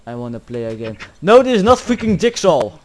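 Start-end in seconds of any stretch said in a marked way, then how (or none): 1.2-2.8 loud, high outburst of protest; irritated shout. Real, not acted. Player protesting it is not Jigsaw, irritated shout. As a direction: shout the correction in exasperation.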